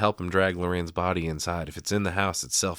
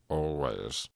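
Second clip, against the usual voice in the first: lightly voiced low, soft drawn brooding reply; a hint of a deep, solemn character voice; Professor Snape; 5 semitones lower, 5 dB quieter than usual. Husband answers his wife in the retold scene, lightly imitating a solemn Snape. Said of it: "Thanks, Professor Snape".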